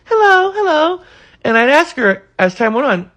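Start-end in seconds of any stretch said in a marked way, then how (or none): 0.0-1.0 friendly greeting. Retold or imitated. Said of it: "she'd walk by with a friendly smile".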